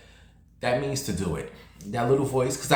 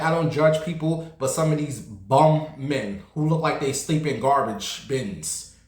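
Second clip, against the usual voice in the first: ordinary talk, nothing marked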